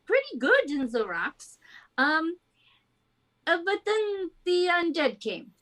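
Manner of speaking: halting captain with a put-on French accent; bad French accent. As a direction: tell the tale in a hokey French accent, pausing often. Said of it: "the worst French accent to ever exist".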